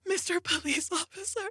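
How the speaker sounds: put-on high, sweet innocent-girl voice; high sugary plea to the officer; sweet angel girl. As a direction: play the helpless good girl for the cops. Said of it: "these very sweet angel girls".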